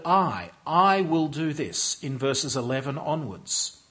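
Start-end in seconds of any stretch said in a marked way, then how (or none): 0.0-2.0 I leaned on firmly each time; "I" given weight as the point of the sentence; stress on I. Shown as a demonstration. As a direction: lean hard on the word "I". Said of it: "with the simple word".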